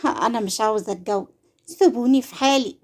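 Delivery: ordinary delivery, nothing marked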